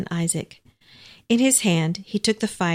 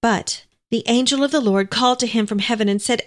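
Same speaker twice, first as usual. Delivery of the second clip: ordinary delivery, nothing marked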